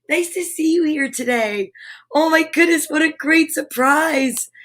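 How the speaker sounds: ordinary delivery, nothing marked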